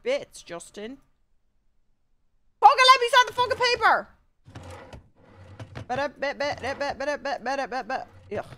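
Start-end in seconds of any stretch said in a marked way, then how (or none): 2.6-4.1 high, loud scream of frustration; exasperated high-pitched outburst; frustrated yell. Real, not acted. Yelling at an uncooperative game. 5.9-8.1 rhythmic babble to fill time; idle rhythmic ba-da filler noise; nonsense scatting. Real, not acted. Filler noises while fiddling in game.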